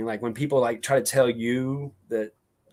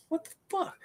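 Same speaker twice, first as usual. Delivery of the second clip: shocked disbelief; 9 dB quieter than usual. Retold or imitated. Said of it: "I'm like".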